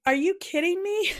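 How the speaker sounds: high, incredulous cry; can't believe it's wrong again; exasperated disbelief. Retold or imitated. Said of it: "And I'm like".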